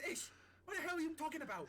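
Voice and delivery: high, loud irritated shout; annoyed yell; exasperated crew member. Acted. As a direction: demand an explanation, thoroughly fed up. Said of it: "and he goes".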